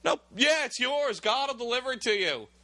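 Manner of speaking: mock-eager sarcasm, pitched high; sarcastic. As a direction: toss off the blessing with a sneer. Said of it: "probably the way he said it".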